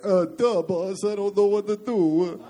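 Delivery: dopey robot, slow and dull; dumb robot voice. Pretend. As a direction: play a slow-witted robot sidekick. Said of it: "Jersey robot".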